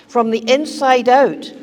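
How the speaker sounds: normal talking voice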